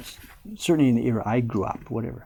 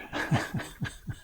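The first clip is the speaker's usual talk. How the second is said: soft, voiceless laughter; helpless laugh; 7 dB quieter than usual. Real, not acted. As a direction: laugh softly despite yourself. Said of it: "I don't know why I'm laughing".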